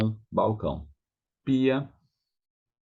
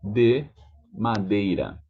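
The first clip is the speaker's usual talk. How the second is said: ordinary delivery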